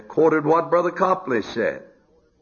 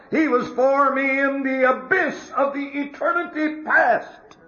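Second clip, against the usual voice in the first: soar up and proclaim each line; preaching at full force; 12 semitones higher than usual. Acted. Preaching climax, high and loud.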